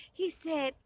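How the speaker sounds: ordinary delivery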